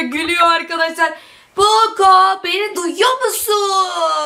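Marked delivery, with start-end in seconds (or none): 1.6-4.3 high, drawn-out call to a character. Real, not acted. Girl checking if Poco hears her, calling out. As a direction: call out higher, stretching the last words.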